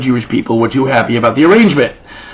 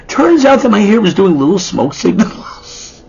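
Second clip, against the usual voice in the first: normal talking voice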